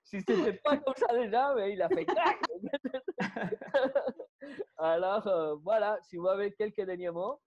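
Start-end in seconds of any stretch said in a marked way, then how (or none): 0.0-2.4 high, loud amused laughing talk. Real, not acted. Laughing while describing actor. 2.4-4.7 long laugh. Real, not acted.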